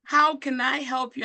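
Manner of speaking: imitated high, lilting hello; welcoming phone greeting. Retelling how she answered an unknown caller, welcoming greeting. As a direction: imitate her eager welcome, pitched well up. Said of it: "But you were like".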